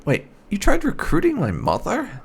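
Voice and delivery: ordinary talk, nothing marked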